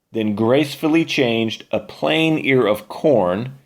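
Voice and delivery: ordinary delivery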